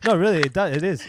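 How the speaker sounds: usual speaking voice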